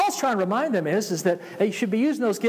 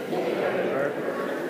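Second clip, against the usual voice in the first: whispered; 5 semitones lower, 4 dB quieter than usual. Shown as a demonstration. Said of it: "Tap your neighbor, say".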